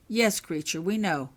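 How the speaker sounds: ordinary delivery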